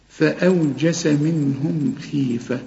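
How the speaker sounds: فأوجس drawn out slowly with a crisp thin جيم; slow recitation. Shown as a demonstration. Teacher models the verse for a student, slow and careful. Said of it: "كل جيم أنت عارف إنها تعطش".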